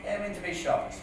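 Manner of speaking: quiet aside. Real, not acted. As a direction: drop out of the recital and mutter it. Quiet aside repeating the line.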